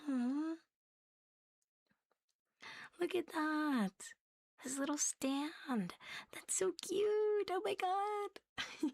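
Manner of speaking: gushing aww. Real, not acted. A cat vlogger gushes to viewers over the little stand, very high and slow. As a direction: swoon over the tiny stand.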